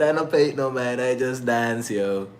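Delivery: ordinary talk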